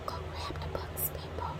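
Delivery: hushed, whispered urging; whispered aside. Real, not acted. Vlogger urging people to take the free books, whispering. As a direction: drop to a whisper to nudge them.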